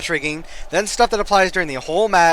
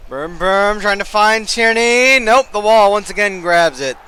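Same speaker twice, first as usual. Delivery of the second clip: excited commentary; 4 semitones higher, 6 dB louder than usual. Acted. Live match commentary.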